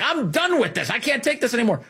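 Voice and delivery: high, whiny mock outrage, a parody of someone fed up; mock exasperated leftist. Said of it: "people are like".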